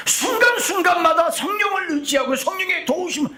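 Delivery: full-force preaching shout. Real, not acted. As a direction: shout it out from the pulpit. Preaching climax.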